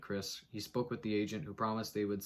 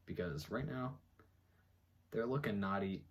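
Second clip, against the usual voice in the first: ordinary talk, nothing marked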